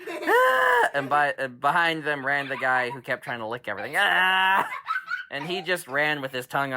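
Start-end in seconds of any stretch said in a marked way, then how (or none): 0.1-0.9 high loud raptor wail; crying wail. Acted. Beaten raptors crying on the forest floor, high and loud. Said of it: "they just laid on the ground and cried".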